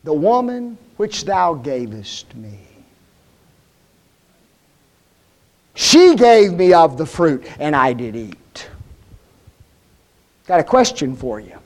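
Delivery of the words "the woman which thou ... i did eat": Adam shifting blame to Eve, low and slow; sheepish Adam. Acted. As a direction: mumble the blame like a caught man. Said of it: "remember what Adam said?".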